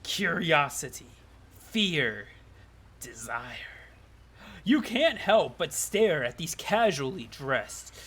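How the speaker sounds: slow, sultry-dramatic storytelling; hammy visual-novel narrator; story narration. A narrator's reading. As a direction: draw out key words with suspenseful pauses. Reads game narration aloud.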